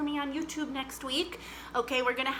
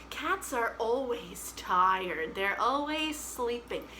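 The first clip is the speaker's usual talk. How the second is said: 1 slow sleepy cat talk; 2 semitones lower, slower than usual. Pretend. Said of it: "Can we pretend that we are cats?".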